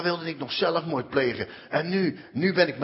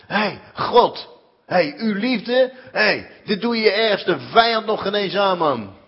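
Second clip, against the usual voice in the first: louder, raised accusation; angry at God; 4 semitones higher, 6 dB louder, slower than usual. Real, not acted. Shouting his anger at God in his cell. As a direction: confront God with bitter outrage.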